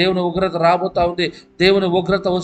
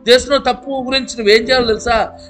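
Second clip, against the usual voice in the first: raised, pressing pulpit voice; fiery preaching; 6 semitones higher, 3 dB louder than usual. Real, not acted. Preacher urging the congregation, full-force preaching. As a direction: preach it hard to the crowd.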